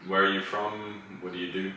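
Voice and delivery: usual speaking voice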